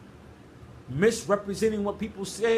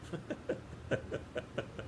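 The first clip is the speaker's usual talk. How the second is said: soft, low run of chuckles; 5 semitones lower, 13 dB quieter than usual. Real, not acted. Preacher recalling a message that contradicts itself, soft chuckle. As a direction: chuckle quietly to yourself.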